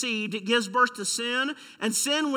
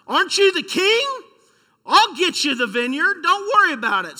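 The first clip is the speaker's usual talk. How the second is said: higher and louder, a hint of a demanding queen; scolding wife; 8 semitones higher, 6 dB louder than usual. Pastor voices Jezebel scolding her sulking husband, higher and louder. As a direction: needle him like an impatient queen. Queen promises to get vineyard.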